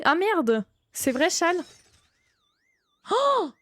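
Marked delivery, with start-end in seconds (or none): 3.1-3.5 startled oh. Real, not acted. Sudden scare in horror game.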